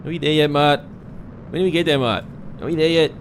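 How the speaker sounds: put-on high whiny nagging kid; pestering child. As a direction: pester Mart like an impatient child. Mock impatient kid nagging Mart.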